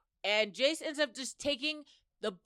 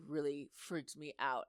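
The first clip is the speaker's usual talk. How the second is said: normal talking voice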